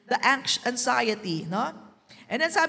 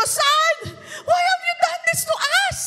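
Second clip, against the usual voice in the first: much higher and louder, a panicked mother's cry; frantic mother; 5 dB louder than usual. Shown as a demonstration. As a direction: shout it like a mother after three days searching. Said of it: "Walang nanay na kalma doon".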